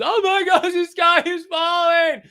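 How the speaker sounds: high, loud, stretched-out mock panic; panicked doomsayer. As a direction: shriek it like the sky is really falling. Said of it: "I'm not going to be the guy who's".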